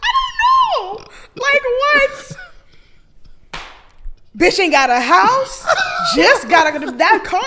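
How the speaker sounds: high, loud, slow laughing outburst; cracking up while yelling; laughing shout. Real, not acted. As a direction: shout it through laughter in disbelief. Laughing outburst over lost money.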